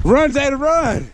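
high, urgent cheering on; excited cheering. Real, not acted. Dad cheers daughter on during tag, excited and high. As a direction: urge her to run, laughing.

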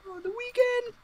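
the weekend squealed very high. Acted. Squealing echo.

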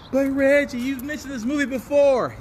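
high, lightly voiced questioning fan; a hint of a nagging viewer; mock viewer voice.

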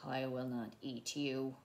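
low, soft character voice; hushed, whispery refusal. A narrator's reading. Theodore refusing to eat the bird, low and hushed. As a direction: say it low and softly. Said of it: "he whispered".

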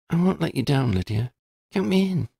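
put-on a Ravenclaw girl asking gently if it's serious; lifted young voice for Shona; Shona, curious schoolgirl. A narrator's reading. Shona commits.

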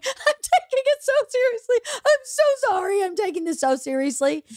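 high, tearful laughing apology; can't keep it together. Acted. Defendant apologizing to the judge, helplessly laughing. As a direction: squeak out an apology while helplessly cracking up. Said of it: "I can't keep it together".

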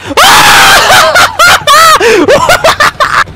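high panicked laughing shriek; shrieking laugh-scream. Real, not acted. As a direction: burst out screaming and laughing at once. Jump scare in horror game.

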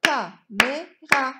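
"Caméra" in three slow, loud beats; syllable by syllable. Shown as a demonstration. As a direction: punch out "Ca", "mé", "ra" separately. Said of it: "On va frapper les syllabes".

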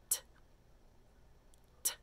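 "T" as a tiny unvoiced tick; quick sound. Shown as a demonstration. Teacher quizzing long or fast, "T" as quick tick. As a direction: tap out "T" with no vowel. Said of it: "That was very fast".

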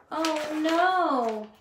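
high, wailing mock bawl. Unicorn bawling over his lost colors. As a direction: wail it like a lost child. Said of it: "bawled the unicorn".

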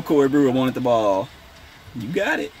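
ordinary delivery

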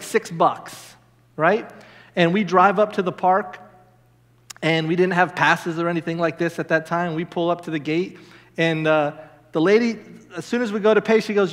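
ordinary talk, nothing marked

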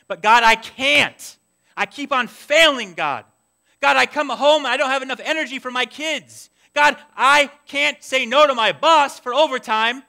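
anguished prayer. Acted. Struggling parent and spouse confessing to God, loud desperation. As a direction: cry out to God in frustration.

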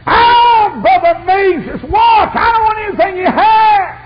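high, drawn-out preacher's shout; loud, slow, soaring holler; impassioned cry. Retold or imitated. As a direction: belt out the plea at full force. Girl's prayer cried out in preaching.

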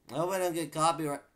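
high, fast, loud; loud mock worry. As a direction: blurt it out in a rush. Repeats it loudly.

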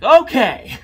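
high, loud mock okay; exaggerated, over-projected imitation; imitating a too-loud reply. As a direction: mimic someone overdoing the request to speak up. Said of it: "You gotta speak a little louder".

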